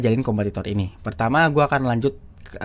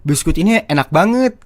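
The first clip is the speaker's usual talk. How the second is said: higher, louder hyped-up food-video delivery; gaya atraktif; 6 semitones higher, 6 dB louder than usual. Retold or imitated. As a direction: sell it to the audience, punching up "enak banget". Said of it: "cara ngomongnya lebih atraktif". (gaya bicara atraktif, nada tinggi dan lantang)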